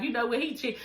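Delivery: quiet knowing verdict; hushed aside. Retold or imitated. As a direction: lean in and murmur it to the husband. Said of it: "I was like".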